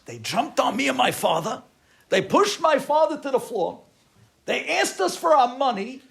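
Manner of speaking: loud, high, heated account; agitated retelling. Retold or imitated. Son telling how muggers attacked his father, loud agitated voice. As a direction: recount the attack with rising outrage.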